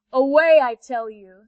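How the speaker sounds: loud, commanding panther; angry big cat shouting; panther's loud command. Acted. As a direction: order them out of the way. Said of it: "said the panther".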